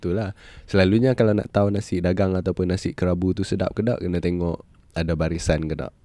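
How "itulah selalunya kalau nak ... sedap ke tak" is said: ordinary talk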